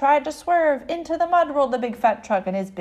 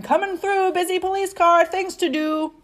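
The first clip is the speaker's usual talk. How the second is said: raised, pushy vehicle voice; busy police car; 6 semitones higher, 4 dB louder than usual. A narrator's reading. Police car to traffic, calling out busily. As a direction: call out to clear the way.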